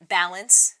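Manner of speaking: BALANCE loud and drawn out, in audible air quotes; air-quoted BALANCE. Real, not acted. Host naming what she meant to ask fellow moms, air-quoted BALANCE. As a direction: set BALANCE apart, slow and heavy. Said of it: "big air quotes".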